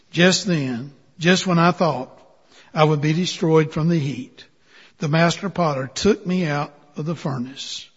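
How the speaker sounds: ordinary delivery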